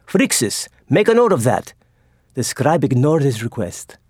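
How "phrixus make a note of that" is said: excited dictation. A narrator's reading. Admiral urging his scribe amid danger, excited. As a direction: still shaken, press the scribe to write.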